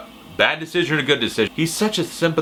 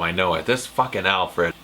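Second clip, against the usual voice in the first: usual speaking voice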